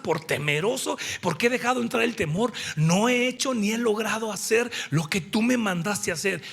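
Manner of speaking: ordinary delivery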